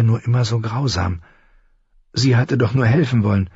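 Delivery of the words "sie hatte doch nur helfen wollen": ordinary delivery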